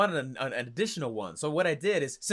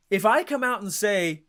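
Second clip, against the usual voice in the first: ordinary talk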